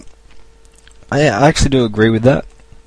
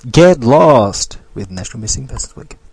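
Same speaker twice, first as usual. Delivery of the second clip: slow, put-on announcer read; drawn-out parody sponsor announcement; mock radio sponsor. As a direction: read it like a spoof station ident.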